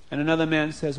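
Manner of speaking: ordinary delivery, nothing marked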